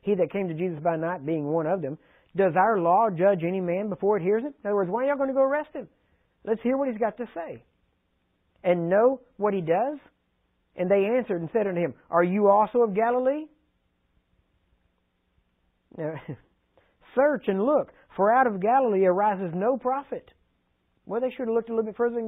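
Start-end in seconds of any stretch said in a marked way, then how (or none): 2.3-4.5 scripture reading. Acted. Reads scripture aloud. 8.6-20.3 scripture reading. Acted. Reads scripture aloud.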